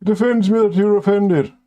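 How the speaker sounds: high, prissy whining parody; mocking offended person. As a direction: mimic a touchy offended person. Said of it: "instead of pretending, "Oh yes".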